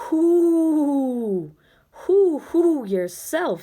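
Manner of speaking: an owl answering Ben, high and clear. Acted. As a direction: hoot it back high and clear. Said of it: "said a high, clear voice".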